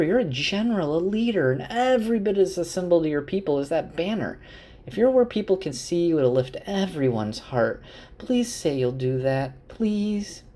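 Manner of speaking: hammed-up sugary pleading, pitched high; over-the-top smile. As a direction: coax him through a huge fake smile. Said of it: "super over-the-top smile and says".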